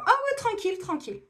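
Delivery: high, easygoing "no worries" act; carefree reassurance. Retold or imitated. Imitating laid-back Africans who say all is fine, carefree. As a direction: toss it out high, then settle. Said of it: "bah, ça va toujours".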